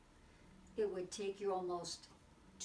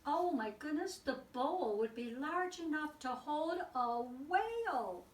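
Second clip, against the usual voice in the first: high, loud gasp of wonder at the size; bright storybook amazement, higher and louder; amazed exclamation; 6 semitones higher, 4 dB louder than usual. Acted. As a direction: wow the kids with it. Amazed reader, huge bowl.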